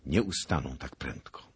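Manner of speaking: plain reading voice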